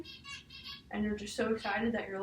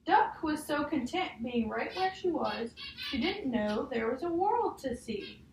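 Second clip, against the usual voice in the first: put-on reading a picture book aloud to a child; measured storybook read, each page leading to the next; story reading; 5 semitones higher, 4 dB louder, slower than usual. Teacher reading a picture book to a child, storybook voice.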